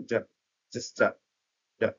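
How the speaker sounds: "যা" as a quick weak form of do; reduced do. Shown as a demonstration. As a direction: clip do down to a short "যা". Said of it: "ডু কে তারা খুবই সংক্ষেপে উচ্চারণ করার জন্য".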